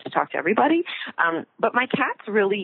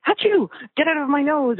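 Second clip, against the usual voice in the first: mock sneeze; 8 semitones higher than usual. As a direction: fake an achoo and shout it off. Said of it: "let's say".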